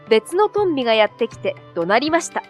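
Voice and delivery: usual speaking voice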